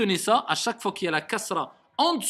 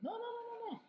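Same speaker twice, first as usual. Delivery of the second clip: high protest; 14 dB quieter than usual. Real, not acted. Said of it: "stopping student's mistake".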